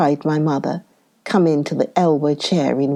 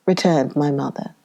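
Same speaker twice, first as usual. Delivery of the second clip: ordinary delivery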